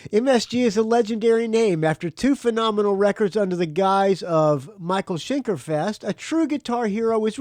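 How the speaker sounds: high, hyped announcer voice; grandly declaimed press release; promo read. Acted. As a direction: read the blurb as big rock hype. Reads album promo aloud.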